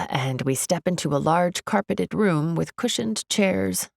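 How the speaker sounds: ordinary delivery, nothing marked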